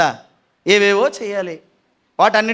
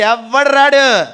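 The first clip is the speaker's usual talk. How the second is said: slow emphatic; 4 semitones higher, 6 dB louder, much slower than usual. Acted. Preacher stressing nobody comes.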